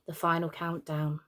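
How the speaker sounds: plain reading voice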